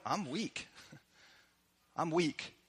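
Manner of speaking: a quiet, drained admission of "I'm weak"; soft confession. Pretend. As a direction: let it fall away, like admitting weakness. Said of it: "would say".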